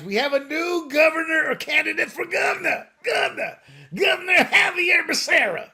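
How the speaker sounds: exaggerated Southern accent, building to a shout on the name; mean Southern accent. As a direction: ham up a bigoted Southern accent. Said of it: "I say it in that mean, racist, bigoted Southern accent".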